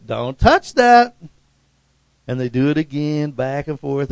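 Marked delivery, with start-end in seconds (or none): 0.1-1.2 kid's mocking echo. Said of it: "and they say".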